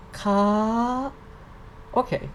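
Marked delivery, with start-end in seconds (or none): 0.1-1.1 "ka" high, gliding upward. Shown as a demonstration. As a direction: stretch "ka" as it climbs. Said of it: "represent the high sound".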